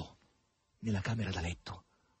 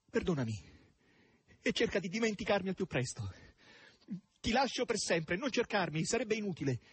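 higher, earnest reading of a farewell letter; a character's parting words, read higher; Margherita's farewell note; 9 semitones higher than usual. A narrator's reading. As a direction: voice the note as the woman who wrote it, raised and tense.

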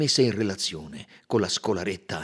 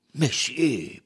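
a sharp falling "Monsieur", higher and louder; protesting; 6 semitones higher, 4 dB louder than usual. A narrator's reading. Monsieur Buck protesting the colonel's threat, indignant. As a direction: object to the insult. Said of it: "protestò Monsieur Buck".

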